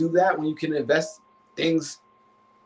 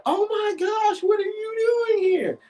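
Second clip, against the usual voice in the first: high, startled roommate voice with a shouted gasp; caught-off-guard roommate squealing at the door; surprised roommate; slower than usual. Retold or imitated. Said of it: "She's like".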